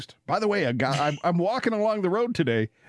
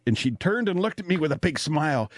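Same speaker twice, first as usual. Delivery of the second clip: ordinary talk, nothing marked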